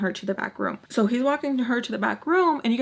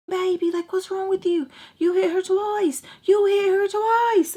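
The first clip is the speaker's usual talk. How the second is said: imitated yelling; 10 semitones higher, 4 dB louder than usual. He yells at her for hitting twice, imitated high yell. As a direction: retell his yelling, high and building louder. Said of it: "like, yelling at her".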